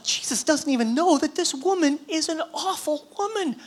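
high-pitched, scandalized inner complaint; hammed-up outrage at a dinner guest; indignant Pharisee's thoughts. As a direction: fret in a high put-on voice, appalled. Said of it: "the Pharisee is thinking to himself".

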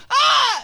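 sudden high loud yelp; scared scream. Shown as a demonstration. Getting scared by someone, high scream. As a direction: yelp like you just got scared. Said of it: "getting the shit scared out of you".

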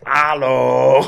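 a hammed-up Upper Michigan Yooper hello, laughing through it; Yooper accent. Mimicking how the brother greeted them from the truck, Yooper accent. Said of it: "like, straight Yooper, man".

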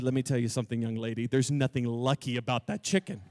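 a hint of a scolding grown-up; mock stern adult. Speaker lecturing the girl about her chicken Lucky, in a low, mock-stern voice.

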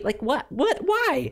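rising, disbelieving what and why; baffled outburst, pitch climbing high; incredulous. Real, not acted. Incredulous reaction to scene.

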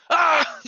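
high loud villain roar; a furious scientist's wordless shout; mad scientist yell. Retold or imitated. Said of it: "The angry one".

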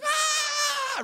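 put-on high excited baby shriek; toddler's gleeful yell. Toddler running into the water, high happy shriek. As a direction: yelp like a toddler charging the sea. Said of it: "He's like".

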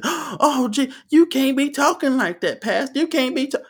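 very high, gasping mock outrage; scandalized churchgoers. Churchgoers scolding the pastor, hammed-up shock. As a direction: squeal it like a shocked congregation. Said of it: "People in the background saying".

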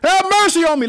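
high, loud cry for mercy; shouted plea. Retold or imitated. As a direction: beg at the top of your voice. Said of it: "he shouted all the more".

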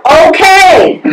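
high, resigned give-in; surrendering okay. Retold or imitated. Answering the insistent voice, high and resigned. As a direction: throw your hands up and give in. Giving in, hands up.

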